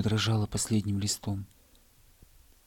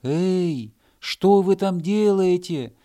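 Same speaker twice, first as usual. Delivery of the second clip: higher, louder, slow call; shouting; 8 semitones higher, 7 dB louder, much slower than usual. Acted. Hedgehog shouting down from the hill, slow call. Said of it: "крикнул с холма ёжик".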